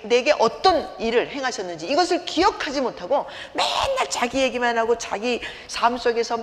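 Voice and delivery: usual speaking voice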